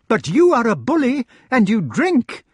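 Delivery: high, loud feminine retort; accusing lady. A narrator's reading. Lady calls her husband a bully, accusing. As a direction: throw the blame back in a high voice.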